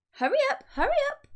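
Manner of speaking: high, loud hurried urging; urgent cry. A narrator's reading. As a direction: hurry them along at full pitch. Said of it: "cried Mugglewump".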